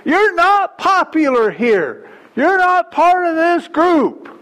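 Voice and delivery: hammed-up angry put-down; acted scolding. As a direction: shout him down like a hostile crowd. Acted crowd silencing the beggar.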